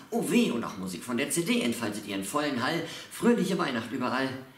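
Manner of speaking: loud poem climax, building in pitch and volume; worked-up, declaimed uproar; escalating frenzy. Acted. Poem builds to screaming family chaos.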